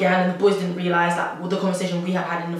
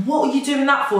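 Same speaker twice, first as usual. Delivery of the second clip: higher, indignant retold question; mock indignant demand; retold annoyance; 7 semitones higher, 4 dB louder than usual. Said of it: "I got really pissed off".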